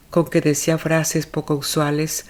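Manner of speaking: plain reading voice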